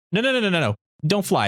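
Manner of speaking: high, quick string of no's; mock protest. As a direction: object like a kid brother, lightly voiced. Retold protest from kid brother.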